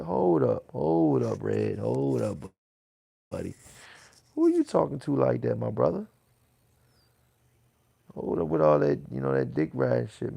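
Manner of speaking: loud, heated warning; angry hold up. Real, not acted. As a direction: shout him down, loud and hard. Confronting chatter The Red.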